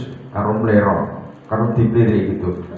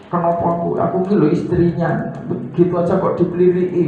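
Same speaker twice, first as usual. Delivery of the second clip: raised, aggrieved wife's lament, a little fast; hurt wife; 7 semitones higher, faster than usual. Retold or imitated. Preacher voicing a wife glared at by her husband, aggrieved and raised. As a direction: voice the stung wife asking why me.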